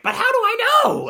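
high, loud screech of a question; mock screeching confused voice. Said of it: "screeching, like".

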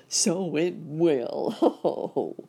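very slow words opening into ho-ho laugh; laughing uncle. A narrator's reading. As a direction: draw it out, then chuckle heartily. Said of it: "laughed Uncle Wiggily".

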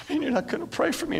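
soft, high-pitched hurt question; imitated tearful plea. Woman asking the instructor for prayer, imitated tearful plea. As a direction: plead softly and high, imitating a wounded woman.

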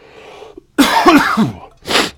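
hacking smoker's cough; harsh coughing fit. Real, not acted. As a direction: cough hard after a big hit. Coughing after hit.

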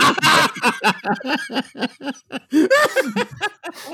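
high-pitched burst of laughter. Real, not acted. Laughs at wife's retort.

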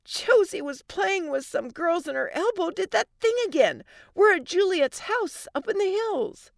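high, frantic phone report; yelling. Acted. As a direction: pitch it up urgently over background wailing. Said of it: "Allie yelled over the wailing".

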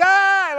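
high mock yell; a short hammed-up "ah" like a showboating wrestler; imitated wrestler roar. Hulk Hogan tearing his shirt, mock roar. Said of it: "he'd tear his shirt and be like".

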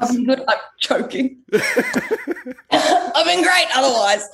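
slow words squeezed out between real laughs; laughing through. Real, not acted. Guest recovering from a choking fit, laughing. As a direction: still cracking up while answering.

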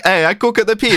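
high, hammed-up cartoon Italian accent; mock Italian accent. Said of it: "listen to the accent and it's like".

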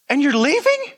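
rising, disbelieving question to a leaving leader; half-laughing disbelief, pitched high; incredulous. Retold or imitated. Pastor retelling his reply to a committed leader who is leaving: incredulous, high and rising.

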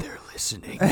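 soft, unvoiced paranoid whisper; mock conspiracy whisper. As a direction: whisper it like they're being spied on. Conspiracy joke about being watched.

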